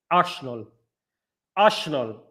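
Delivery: low mock Dutch accent with "sh" in Arsenal. Shown as a demonstration. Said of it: "That's a Dutch accent".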